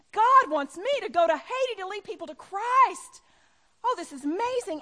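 acted elated certainty, high and very loud; fervently convinced. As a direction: proclaim it like a thrilled new believer. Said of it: "so you're convinced".

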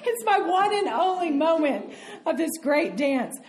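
high burst of laughter, then laughing through words. Real, not acted. Storyteller laughing at her one great dance. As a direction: laugh out loud at the memory.

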